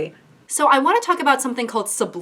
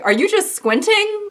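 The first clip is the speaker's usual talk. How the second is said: much higher, disbelieving question; incredulous; 11 semitones higher than usual. Real, not acted. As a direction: pitch it way up in disbelief. Mocking subliminal result photos.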